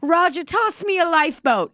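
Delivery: high, theatrical cry for rescue; scared Mid-Atlantic accent. Acted. Castaway begging Roger for rescue, hammed-up fright. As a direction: plead in mock Mid-Atlantic panic. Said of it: "they got so scared".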